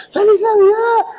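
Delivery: very high mock wail; tearful greeting. Family greeting the lost son at Christmas, high tearful mock wail. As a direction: wail it like overjoyed crying, imitated.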